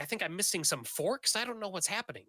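a touch frazzled and baffled; flustered. Acted. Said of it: "she's flustered".

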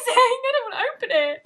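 overjoyed laugh-cry; laughing through tears. Real, not acted. Overwhelmed by the gift, loud tearful laugh. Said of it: "I'm actually crying".

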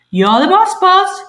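high, loud parrot squawk; cockatoo squawk. Acted. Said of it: "squawked the cockatoo".

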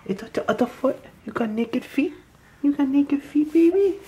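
high soft cooing. Real, not acted. Cooing at toddler.

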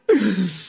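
short laugh; a quick real laugh. Real, not acted. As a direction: chuckle.

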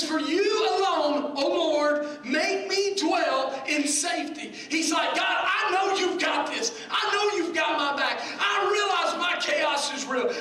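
loud, high pulpit cry; impassioned shouted sermon; full-force preaching. Real, not acted. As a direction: press every phrase home at full voice.

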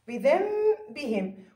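بذنبهم very slow, nun hummed as a long m; iqlab with ghunna. Shown as a demonstration. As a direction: stretch an m in place of the ن in بذنبهم. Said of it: "كيف لفظتها؟".

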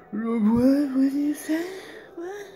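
high, slow, loud drawn-out what; mock a dazed woman, stretching it out high; acting like she's on something. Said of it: "probably on something because of how, how she was acting".

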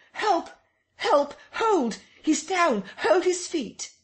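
very high, loud frantic cries; screaming. Acted. Kemp screams to the crowd for help holding the man. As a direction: yell desperately for help. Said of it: "screamed Kemp".

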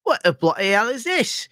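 put-on high-pitched, over-the-top British accent; hammed-up Brit in high-voiced outrage; mock British accent. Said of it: "but I don't go".

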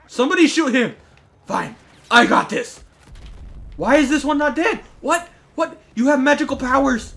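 very high, loud outburst; frantic yelling. Real, not acted. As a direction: shriek in exasperated disbelief. Yells as enemy will not die.

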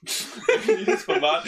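slow words through a laugh; laughing while speaking. Real, not acted. Laughs mid sentence.

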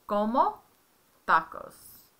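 "Como tacos" said in careful Spanish; Spanish phrase modeled for a learner. Shown as a demonstration. Parent models the right Spanish for the child. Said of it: "you would just say".

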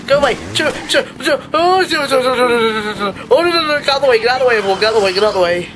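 high stammer tumbling into pleading; stammer, then repeat the plea frantically; flustered panic. Real, not acted. Player fleeing past everyone in the way, frantic.

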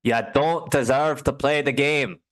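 high, loud, slow Scottish brogue; mock Scottish telling-off to viewers who fold; Scottish accent. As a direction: scold them in a thick Scottish accent. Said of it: "best Scottish accent I've heard".

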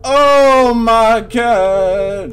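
loud, very drawn-out cry; long, high moan of dread; dismayed wail. Real, not acted. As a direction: wail it, stretching every word. Dismayed during scary chase.

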